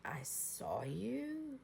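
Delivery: near-whisper, very soft and drawn out; barely voiced, acted disclosure; hushed revelation. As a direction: re-enact a secret confided slowly. Retold hushed revelation to officer.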